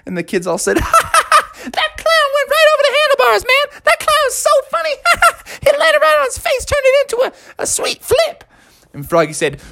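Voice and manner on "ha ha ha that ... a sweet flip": put-on very high squeaky laughing kid; delighted kid voice. Kids watch the clown flip, delighted high kid voices. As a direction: crow like a tickled little kid.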